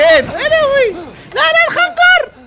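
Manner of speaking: very high loud cry of alarm; real panic over the dagger; shrill loud yelp; panicked shriek. Real, not acted.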